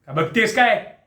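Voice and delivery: harsh command. Acted. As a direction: push her on roughly. Ordering wife onward.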